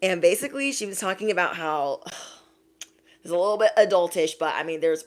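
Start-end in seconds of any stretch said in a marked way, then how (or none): none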